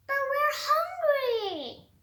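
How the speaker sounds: high, slow whine; whiny kid. Acted. Kids whining to Petey for snacks, high whine. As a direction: drag it out like a hungry child.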